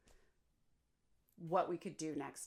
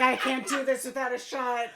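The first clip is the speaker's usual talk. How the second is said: high, loud mocking husband voice; loud, laughing imitation of a fed-up husband; 5 semitones higher, 10 dB louder than usual. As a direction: ham up the desperate husband. Joking husband voice quote.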